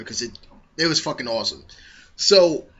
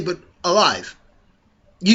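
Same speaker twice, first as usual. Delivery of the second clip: usual speaking voice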